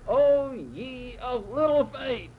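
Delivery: high, very slow rebuke; Jesus rebuking his disciples, high and very slow; chewing them out. Retold or imitated. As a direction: scold the disciples, dragging each word. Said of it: "and just chews them out".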